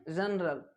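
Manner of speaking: जनरल said low with j; soft g. Shown as a demonstration. Teacher drilling the word: soft j. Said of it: "क्या बोलेंगे".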